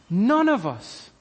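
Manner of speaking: normal talking voice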